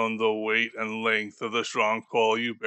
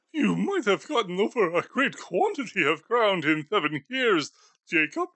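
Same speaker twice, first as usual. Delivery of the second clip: put-on higher, frail old miser, anxious; a nervous old skinflint questioning a ghost; Scrooge voice; 9 semitones higher than usual.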